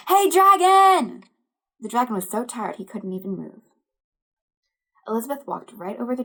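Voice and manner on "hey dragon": much higher, much louder, slow yell; huge shout. Acted. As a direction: yell right into the dragon's ear. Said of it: "shouted as loud as she could".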